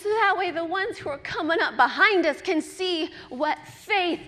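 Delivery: loud, high, building exhortation; rallying sermon climax; impassioned preaching. Real, not acted. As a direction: preach it with mounting fervour. Preaches at full force.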